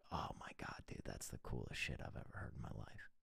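unvoiced whisper, choked up; whispered in disbelief; awed whisper. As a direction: breathe it out, overwhelmed. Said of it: "I honestly got choked up".

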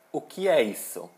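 ordinary delivery

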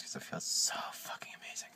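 soft unvoiced aside; whispering. Real, not acted. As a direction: whisper it to yourself.

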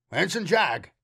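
shouted. A narrator's reading. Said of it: "Aesop shouted, louder than he needed to".

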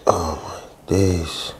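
usual speaking voice

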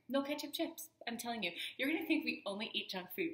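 ordinary talk, nothing marked

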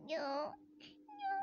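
high bleating little sheep crying no. Acted. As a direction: plead like a scared lamb. Sheep begs not to be killed.

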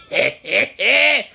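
laughing hard with glee; gleeful laughing. Real, not acted. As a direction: crow with laughter. Gleeful laughing after a win.

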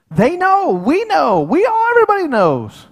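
loud, high, insistent pleading; high-pitched protest. Retold or imitated. Preacher re-enacts his concerns to the Lord, high-pitched protest.

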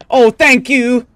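ordinary talk, nothing marked

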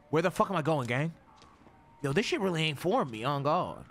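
usual speaking voice

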